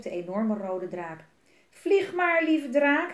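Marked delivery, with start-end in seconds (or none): 1.7-3.1 high, loud, eager command; calling out. A narrator's reading. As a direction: shout gleefully to set the dragon off. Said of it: "riep hij".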